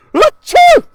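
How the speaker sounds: big high acted sneeze; a huge comic achoo; loud sneeze. Boy in the minivan sneezing loudly.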